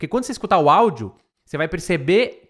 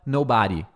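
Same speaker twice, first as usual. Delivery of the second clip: "nobody" as no-BA-dy; common wrong stress; 4 semitones lower than usual. Shown as a demonstration. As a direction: punch the middle syllable of "nobody". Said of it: "a maioria das pessoas". ("nobody" com o "ba" forte)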